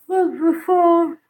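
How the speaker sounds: words stretched through a yawn. Real, not acted. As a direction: talk mid-yawn, slowly. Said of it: "I am yawning".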